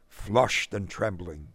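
usual speaking voice

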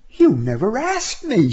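a hint of a young woman; woman's voice. A narrator's reading. Woman's line in argument.

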